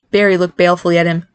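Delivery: usual speaking voice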